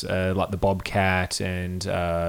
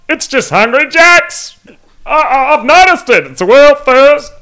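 obnoxious dim-witted Aussie; 13 dB louder than usual. Retold or imitated. Band member voicing made-up bloke Nev from Lightning Ridge, hammed-up high loud Aussie voice. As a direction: ham up a gross, dim bogan bragging about Burger King. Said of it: "talking a bit like this".

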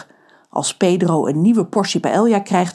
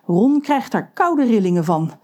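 usual speaking voice

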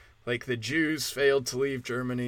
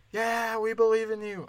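mock distant yell; 7 semitones higher than usual. Imagined listeners yelling support from their cars, mock distant cheer. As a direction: imitate a crowd yelling from far away. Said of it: "and at work yelling".

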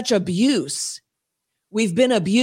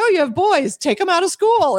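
eager advice; 8 semitones higher, 3 dB louder than usual. Retold or imitated. Retelling her eager advice.

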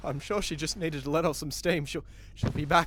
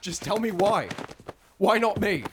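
demanding; 6 semitones higher, 4 dB louder than usual. Acted. Pressing her for an answer.

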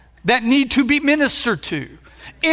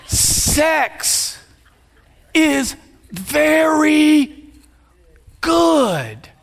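drawn-out shout; 4 semitones higher, much slower than usual. Acted. Preacher builds to climax.

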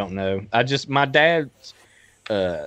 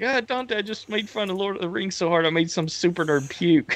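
higher-pitched mock complaint; retold movie character; 8 semitones higher than usual. Quoting Clerks two to co-hosts, higher mock-whiny retelling. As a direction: act out a guy moaning to his buddy. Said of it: "He's like".

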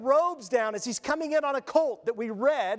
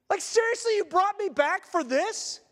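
exasperated Lazarus; 7 semitones higher than usual. Retold or imitated. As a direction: whine it like he can't believe it. Lazarus complaining at being raised again.

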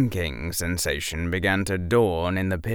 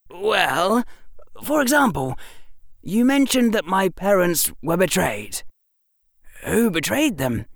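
put-on boyish, eager storytelling; young Harry voice; 10 semitones higher than usual. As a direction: voice a clever young boy. Harry continues.

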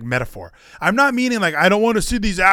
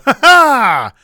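mock laugh; 8 semitones higher, 9 dB louder than usual. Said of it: "And we'd all go".

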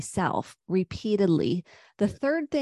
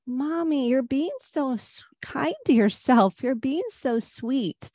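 put-on higher, a light little-girl voice; daughter's voice; 5 semitones higher than usual. Daughter praising mom for being kind to herself, lightly childlike. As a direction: lightly play the young daughter admiring mommy.

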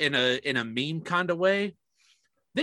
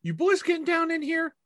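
much higher, teasing quoted voice; imitated other guy; 13 semitones higher than usual. Someone catching the groomsmen at the fridge, higher teasing voice. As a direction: toss the line off like a nosy guest.

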